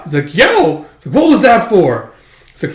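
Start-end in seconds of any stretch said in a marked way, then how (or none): 0.4-0.9 high, loud cry of protest; mock yelp. Character whose foot got stomped, mock yelping. 1.1-2.1 loud, high complaint; mock indignant. Stomped character confronting the attacker, mock indignant.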